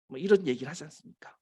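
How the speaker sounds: normal talking voice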